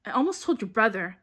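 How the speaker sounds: loud, irritated retort; snapped. A narrator's reading. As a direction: snap it back with force. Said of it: "Hermione snapped".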